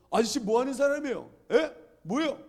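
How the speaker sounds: high, loud challenging questions; retold yelling. Student confronting the intruder in his dorm, yelling. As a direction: shout an indignant demand, acting it out. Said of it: "막 소리를 질렀어요".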